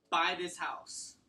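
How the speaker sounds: slow, firm command, stress on buy; firm falling command. Shown as a demonstration. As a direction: say buy this house like an order. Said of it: "here's some example of some embedded commands".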